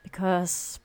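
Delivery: story narration. A narrator's reading. Reads the story over music.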